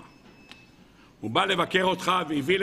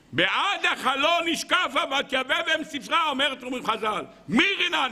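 impassioned preaching; 9 semitones higher than usual. Real, not acted. Rabbi quoting Sisera's mother verse, declaiming high. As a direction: proclaim the verse well above speaking pitch.

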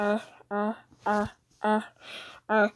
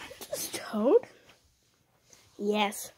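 usual speaking voice

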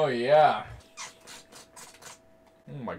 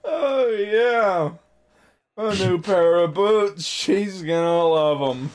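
sarcastic; 5 semitones higher, 5 dB louder, slower than usual. Pretend. Mocking the boots plan.

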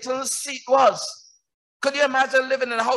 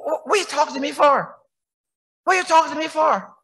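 raised-pitch divine challenge, lightly acted; exasperated Lord; 6 semitones higher, 4 dB louder than usual. The Lord asking Moses why he talks to him, exasperated. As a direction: lightly play God demanding an answer.

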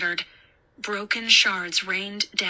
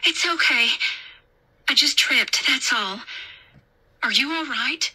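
higher, gentle character voice; raised pitch easing downward; reassuring babysitter; 5 semitones higher than usual. A narrator's reading. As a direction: play down a fall for a scared child. Babysitter reassures after tripping.

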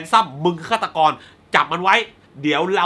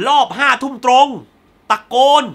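loud, higher, indignant emphasis; really angry; 5 semitones higher, 6 dB louder than usual. Real, not acted. Denouncing students who shouted in the cinema, angry emphasis. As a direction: punch out the accusation in real annoyance. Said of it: "ผมโมโหจริงๆ".

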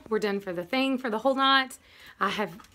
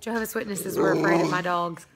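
ordinary delivery

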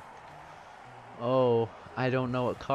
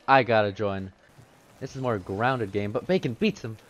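ordinary talk

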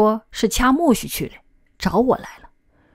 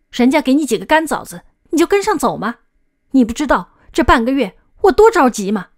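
raised, louder and higher, huffing with anger; angry scolding; 5 semitones higher, 5 dB louder than usual. Acted. Older brother to runaway little brother after half a month's worry, angry scolding. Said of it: "我气呼呼地说".

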